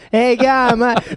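very high, hammed-up Australian; bad Australian accent. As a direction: squeal out a badly faked Australian line. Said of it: "I can't do an Australian accent".

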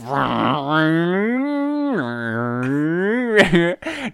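long engine rev climbing high; car noise. Shown as a demonstration. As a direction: imitate a car revving through its gears. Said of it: "I don't wanna scream into the mic".

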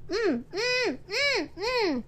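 high muffled "mm, mm" through a covered mouth; muffled protest hum. A narrator's reading. Mister Tall with bandages over his mouth, muffled protest. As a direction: hum urgently with lips shut. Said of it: "He was trying to say".

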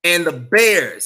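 loud drawn-out announcement. Real, not acted. Host announcing his last topic to viewers, loud and drawn out. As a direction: land "Bears" hard and slow, like a headline.

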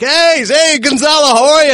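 excited greeting. Retold or imitated. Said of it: "So immediately he's like".

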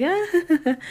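very high laughing squeal; watching the fight from the couch, laughing squeal. Retold or imitated. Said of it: "on the other side of the couch laughing".

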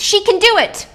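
high, loud angry scream. Acted. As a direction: cut the helper off with a yell. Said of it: "but Sai screams".

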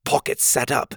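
usual speaking voice

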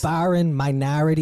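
"Foreign" with a New York O R sound, faɹən; leaning on the R in "Foreign minority"; mock New York accent.

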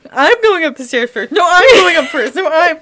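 high, laughing, scared squabble; hammed-up scramble to go first; two friends fighting over the stairs, mock panicked; mock panicked whine. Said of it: "you and I would be like".

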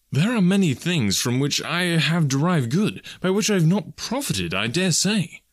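high, animated, heartfelt voice; earnest young nephew. Acted. Nephew defending Christmas to Scrooge, earnest. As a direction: argue warmly for Christmas.

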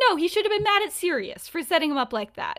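high, quick, indignant retort; acted objection. Lily arguing with James over Severus, acted objection.

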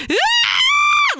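imitated high-pitched toddler scream, very loud; a mother mimicking her toddler's panicked screech; terrified child's screech. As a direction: let out a shrill kid's shriek of terror. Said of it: "that high-pitched screech where they're terrified".

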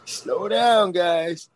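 high, drawn-out playful plea; mock alarm. Host teasing the chat for going wild, mock alarm. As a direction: playfully rein in a runaway crowd.

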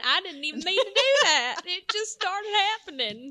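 loud, high Texas twang, laughing; Texas accent. Real, not acted. Host admitting her Texas accent slipped out, loud twang. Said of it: "that's my cow accent".

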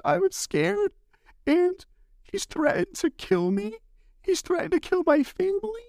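very high, imitated weepy voice; mock tearful testimony. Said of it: "was crying on the stand".

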